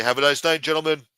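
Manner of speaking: imitating a dismissive doorman; security guard. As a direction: turn them away like a club bouncer. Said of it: "Big-ass security guards used to say".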